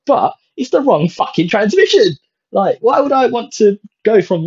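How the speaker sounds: high, indignant outburst at the dealer; exasperated rant about the gearbox. Real, not acted. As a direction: spit out the swear in disbelief. Outrage at wrong gearbox.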